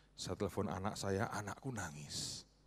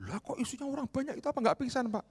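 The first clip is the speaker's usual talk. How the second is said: much higher, amazed; astonished question; 7 dB louder, faster than usual. Retold or imitated. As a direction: gasp it out high and shocked. Businessman astonished, retold.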